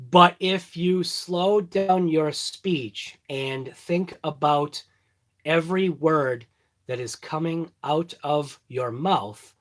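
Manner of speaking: slow and deliberate, every word separate; slow, careful speech. Shown as a demonstration. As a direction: think through each word as you say it. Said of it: "if I talk like that and talk every word".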